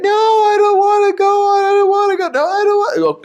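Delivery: put-on high, pleading child's whine; whiny refusing kid. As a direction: whine like a kid who won't go.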